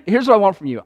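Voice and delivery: normal talking voice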